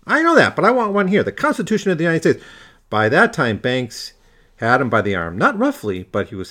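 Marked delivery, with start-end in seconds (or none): 0.1-2.5 higher and louder, demanding his rights; indignant insistence. A narrator's reading. Customer insisting to the barber on his constitutional rights, raised and indignant. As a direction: stand your ground, voice raised.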